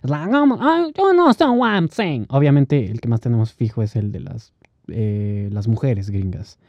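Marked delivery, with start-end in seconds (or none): 0.3-2.2 high, exaggerated Bronx street accent. Imitating people from the Bronx, mock Bronx accent. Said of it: "en el Bronx".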